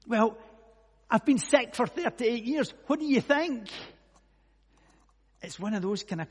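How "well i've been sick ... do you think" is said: loud, high, mock-indignant comeback; exasperated retort. Sick man answering whether he wants to get better, mock exasperation. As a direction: throw the silly question back, exasperated.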